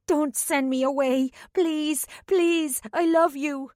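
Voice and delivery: high, rising, begging cry; desperate pleading. A narrator's reading. Ashlyn silently begs Anulan, high and desperate. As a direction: beg not to be sent away.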